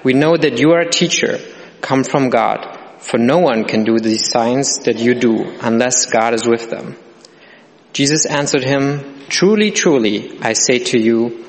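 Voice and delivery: ordinary talk, nothing marked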